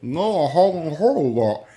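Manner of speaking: high, loud, put-on innocent reply; mock innocent self. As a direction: play it wide-eyed and clueless. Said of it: "I'm like".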